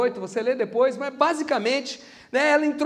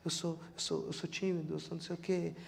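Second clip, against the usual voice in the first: much softer and lower, shrinking; mock timid excuse; 6 semitones lower, 16 dB quieter than usual. Preacher imitating a timid youth during worship, quiet mumble. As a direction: mumble the excuse like someone shy. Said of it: "você tá assim".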